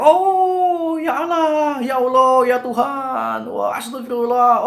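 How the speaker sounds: high, drawn-out wails of panicked passengers; imitated panicked screaming. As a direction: mimic a cabin crying out to God, high and loud. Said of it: "wah, mereka teriak".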